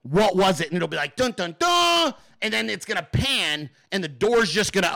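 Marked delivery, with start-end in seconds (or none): 1.1-2.1 slow, high mock TV sting, drawn out; dramatic sting. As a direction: hum it like a cheesy soap-opera cliffhanger.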